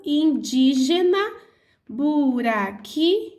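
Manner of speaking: indígena and buraquinhos drawn out slowly, syllable by syllable; syllables spelled out. Shown as a demonstration. Teacher showing polysyllables, slowly sounding them out. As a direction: tap out each syllable for the class. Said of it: "as que têm quatro ou mais sílabas".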